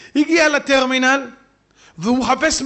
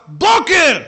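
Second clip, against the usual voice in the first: stern call; 5 semitones higher, 6 dB louder, much slower than usual. Retold or imitated. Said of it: "משה רבנו אומר לקורח".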